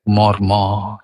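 মর্মর low and evenly drawn out; soft low glide. Shown as a demonstration. Reciter showing wind through leaves, soft gliding মর্মর. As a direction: let মর্মর roll gently in a low register, no stress. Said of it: "কোমল পর্দা গড়িয়ে আসছে".

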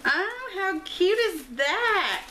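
very slow, high coo. Real, not acted. Mother opening her daughter's gift, slow high cooing.

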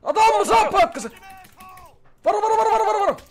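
high, loud commands in a gunfight; real panic under fire; urgent shout. Real, not acted. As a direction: bark warnings at the team. Police arriving, shooting.

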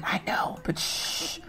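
conspiratorial shushing whisper; hushed secret. Pretend. Streamer swearing viewers to secrecy, hushed whisper. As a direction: shush them softly, like sharing a secret.

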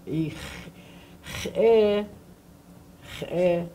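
"Ich eh" with a throaty ch and glottal catch; slow teaching demo; guttural sound. Shown as a demonstration. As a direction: growl the ch, stop before "eh". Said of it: "It's a glottal, I mean a guttural sound".

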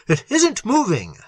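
high, startled cry. A narrator's reading. Little seeing the creature halt ahead, crying out. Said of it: "cried Little".

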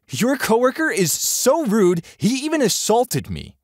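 very high, indignant tattling; high-pitched affronted woman reporting someone; outraged complaint. A narrator's reading.

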